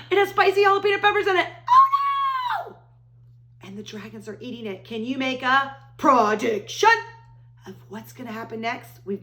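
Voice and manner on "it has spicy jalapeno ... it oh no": mock alarm. Mock alarm at spicy peppers.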